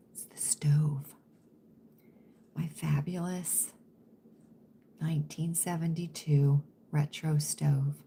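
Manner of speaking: low, secretive half-whisper; hushed confiding. Real, not acted. Confiding to friends about the ailing stove, hushed. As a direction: keep it down, almost whispering. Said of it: "I don't wanna say it too loud".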